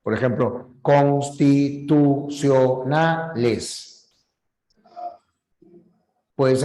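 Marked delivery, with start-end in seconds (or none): 0.8-4.1 "constitucionales" dragged out syllable by syllable; slow, evenly spaced syllables through "constitucionales"; counted syllables. Shown as a demonstration. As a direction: count "constitucionales" on your fingers as you say it. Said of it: "ayudarte con los dedos".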